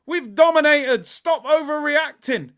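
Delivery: high, loud mock protest; mocking quote. Streamer quoting people at half time, high mocking shout. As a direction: loudly parrot the naysayers, dragging the last words. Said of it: "People at half time were telling me".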